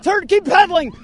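high, insistent calls; urgent calling out. Real, not acted. Coach calling to a rider heading off, urgent calls. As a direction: call urgent directions to someone moving away.

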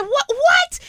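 very high-pitched stammered shock; acted-out startled double take; shocked mother. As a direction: squeak it out in disbelief. Mother's shocked reaction, retold.

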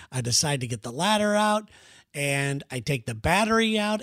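normal talking voice

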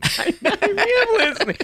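laughing while protesting. Real, not acted. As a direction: squeal it out through laughter.

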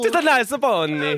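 put-on Italian accent. Joins Italian bit.

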